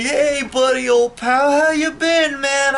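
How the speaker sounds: mock the phony pal sucking up; mocking fake buddy. Vlogger parodying ex-friends crawling back, hammed-up chummy voice. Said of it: "they wanna come back to me".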